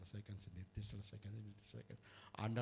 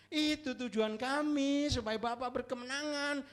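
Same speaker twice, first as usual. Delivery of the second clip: rising fervent appeal; 12 semitones higher, 4 dB louder than usual. Real, not acted. Climax of appeal.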